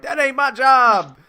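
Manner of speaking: high, loud drawn-out protest; freaked-out TSA agent. Acted. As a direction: holler it like a panicking airport guard. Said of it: "T S A's freaking out".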